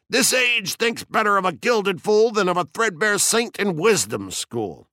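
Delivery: high-pitched quoted outcry; mock yell. A narrator's reading. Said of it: "And you yelled".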